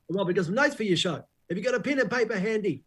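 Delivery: put-on bossy phone voice; Paul Holmes. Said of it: "And he goes".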